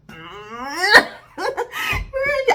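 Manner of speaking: high, loud burst of laughter; a laugh that tips toward a cry; real laugh. Real, not acted. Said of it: "I'm laughing about this".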